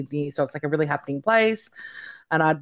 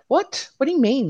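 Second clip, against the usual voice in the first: much higher, incredulous retort; stunned disbelief; 9 semitones higher, 3 dB louder than usual. Retold or imitated. Patient retelling her reply to the ophthalmologist, stunned disbelief. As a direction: replay a jolt of indignant shock. Retold reaction to MS diagnosis.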